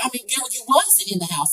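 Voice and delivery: normal talking voice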